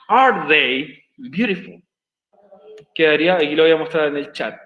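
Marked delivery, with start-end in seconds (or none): 0.1-1.8 pregunta muy lenta. Shown as a demonstration. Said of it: "pasaría a ser".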